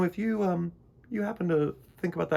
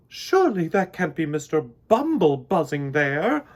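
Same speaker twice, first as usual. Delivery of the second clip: surprised; 3 semitones higher, 8 dB louder than usual. Acted. Queen spotting a bee.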